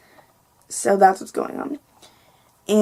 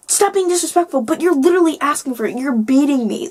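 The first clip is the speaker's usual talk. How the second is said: higher-pitched retold indignation; worked-up argument, retold; heated quarrel; 5 semitones higher than usual. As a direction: argue back, exasperated.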